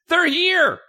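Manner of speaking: high, loud call through the house. Acted. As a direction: mom hollering from the front hall. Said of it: "your mom calls from the front hall".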